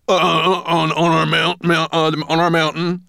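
high, loud, flustered dwarf stammer. A narrator's reading. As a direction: trip over the words in panic. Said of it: "stammered the dwarf".

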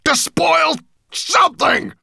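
high, roaring dragon rant; hungry monster at full bellow. A narrator's reading. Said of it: "he bellowed".

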